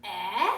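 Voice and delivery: loud, clear eh for the class to repeat; teacher modelling eh for a choral repeat; short e sound. Shown as a demonstration. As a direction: lead the kids in saying eh. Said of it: "say it with me".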